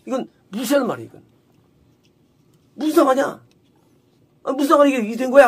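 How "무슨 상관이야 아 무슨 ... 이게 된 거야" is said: acted confrontation. Woman demanding what Elijah has to do with her, confrontational. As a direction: demand an answer, imitating someone picking a fight. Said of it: "따지는 거예요".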